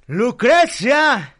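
loud, high, slow calling out; old man calling his wife to the room, loud and drawn out; excited yelling. Acted. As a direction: shout for your wife, thrilled. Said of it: "se emociona y empieza así de".

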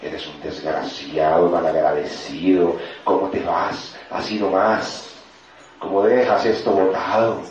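low, slow reproach; accusing inner voices. Acted. Inner voices shaming someone for quitting, accusing. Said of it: "empiezan todas esas voces allá adentro".

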